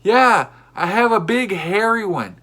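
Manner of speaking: loud, slow, high crazed yell; a crazed man yelling at strangers, loud and slow; psychopath yell. Retold or imitated. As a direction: yell it like a madman. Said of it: "he yells back, like a psychopath".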